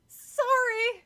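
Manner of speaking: high loud cry of one word; girl screaming a forced apology. A narrator's reading. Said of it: "she finally managed to scream".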